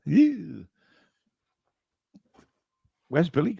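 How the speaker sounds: ordinary talk, nothing marked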